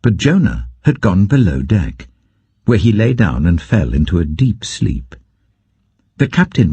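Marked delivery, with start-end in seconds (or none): none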